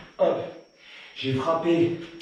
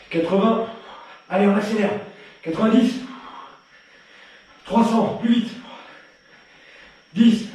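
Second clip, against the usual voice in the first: coach calling out reps; 5 semitones higher than usual. Acted. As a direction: pump the class up as you count every punch. Coach counting punches for class.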